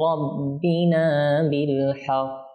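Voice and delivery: slow, melodic Quran chanting; solemn drawn-out tilawa; Quran recitation. Acted. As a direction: draw each word out in measured tajwid recitation. Recites Quran verses with music.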